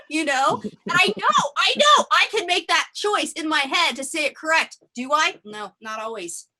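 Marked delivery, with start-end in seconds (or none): none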